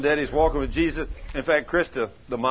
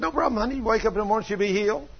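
high-pitched, carefree husband; breezy reassurance; 8 semitones higher, faster than usual. Retold or imitated. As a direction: brush the worry off in a high, easy voice. Acts husband breezily reassuring wife.

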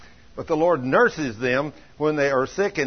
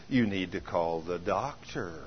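soft, low, slow inner voice; hushed voice of doubt; little nagging thought; 7 semitones lower, 7 dB quieter, slower than usual. Retold or imitated. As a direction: slip it in quietly and slowly, like a creeping doubt. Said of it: "the little thought would come to her".

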